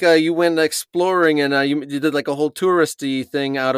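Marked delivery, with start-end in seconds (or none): none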